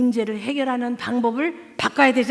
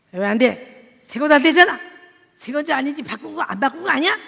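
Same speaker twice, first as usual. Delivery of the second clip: mock irritated husband; 4 semitones higher, 7 dB louder than usual. Husband frustrated over humidifier.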